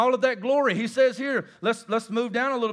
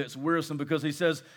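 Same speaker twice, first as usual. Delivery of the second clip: usual speaking voice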